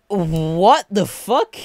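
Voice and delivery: drawn-out, high, baffled outburst; stunned disbelief. Retold or imitated. Buyer finding no disc in the game case, stunned disbelief. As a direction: stretch every word out in disbelief.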